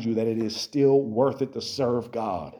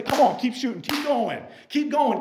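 self pep talk; 8 semitones higher than usual. Pretend. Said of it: "you say".

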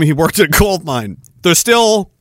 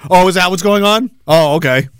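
ordinary talk

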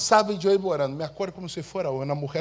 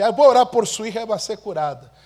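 eager announcement; 5 semitones higher, 10 dB louder, faster than usual. Retold or imitated. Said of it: "Y ahí yo dije así".

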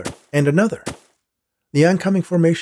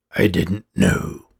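lower, slow gravelly echo; slow snowman echo; 6 semitones lower, much slower than usual. Acted. Snowman echoes the boy's words, slow deep gravel. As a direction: repeat it slowly, deep and simple.

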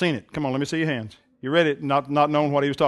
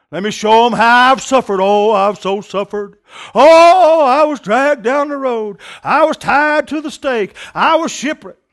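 hammed-up woeful wail, high and loud; milk every hardship, slow and showy; mock suffering; 5 semitones higher, 7 dB louder than usual. Preacher parodying fundraisers boasting of suffering, mock-tragic.

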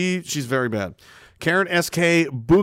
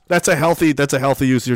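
ordinary talk, nothing marked

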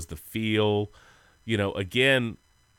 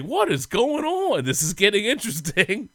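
mock amazement; 11 semitones higher than usual. Telling how he saw the game turn, high mock amazement. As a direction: stretch it out high, playfully astonished. Acts out his amazed reaction.

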